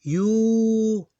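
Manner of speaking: yu held long, high and loud; long vowel demo on yu. Shown as a demonstration. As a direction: stretch yu out fully. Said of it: "that's long sound".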